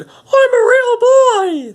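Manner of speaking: put-on very high, loud, squeaky puppet-boy voice; Pinocchio. Puppet proudly claims to be real, loud squeaky falsetto. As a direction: shoot way up into a drawn-out falsetto cry. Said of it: "someone put Pinocchio down and said".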